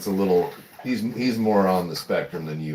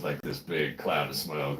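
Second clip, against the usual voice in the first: usual speaking voice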